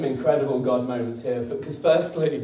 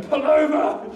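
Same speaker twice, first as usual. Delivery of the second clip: high mock yell at the slow driver; mock road rage; 13 semitones higher, 4 dB louder, slower than usual. Driver yelling at the slow car ahead, mock rage. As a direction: imitate a raging driver shouting. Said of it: "Normally I'd be, like, raging".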